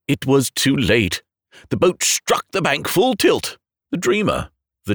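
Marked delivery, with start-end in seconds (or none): none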